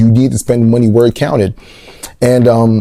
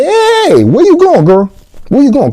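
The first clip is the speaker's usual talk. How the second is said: much higher and louder alarmed call; 3 dB louder than usual. Retold or imitated. A viewer yelling at the character on screen, alarmed and high. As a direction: yell after her like she's leaving.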